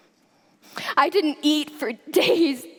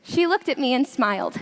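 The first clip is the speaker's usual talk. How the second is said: usual speaking voice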